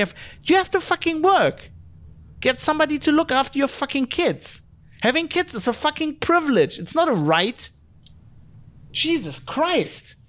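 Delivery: ranting. Real, not acted. Podcaster ranting at parents who want remote work, exasperated. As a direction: vent hard, pitch climbing with exasperation. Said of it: "Sorry, I'm just ranting now".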